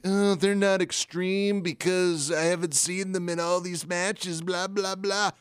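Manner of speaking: high, whiny imitation of an objector; mock complaint. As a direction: parody a complaining listener in a high put-on voice. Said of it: "you're like".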